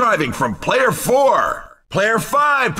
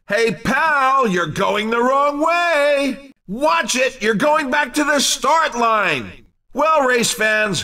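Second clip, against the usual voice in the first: normal talking voice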